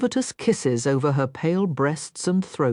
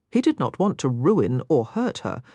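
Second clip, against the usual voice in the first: ordinary delivery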